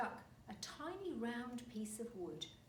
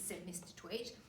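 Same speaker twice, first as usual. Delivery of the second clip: ordinary delivery, nothing marked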